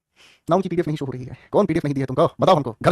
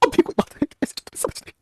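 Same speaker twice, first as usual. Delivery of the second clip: words breaking into a high laugh, fading to breathless; laughing through it; 10 semitones higher, slower than usual. Real, not acted. Teacher laughs mid-question. (हँसते हुए)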